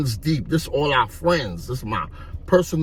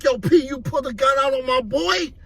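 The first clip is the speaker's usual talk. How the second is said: confronting shout; 10 semitones higher than usual. Retold or imitated. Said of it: "Roy was like".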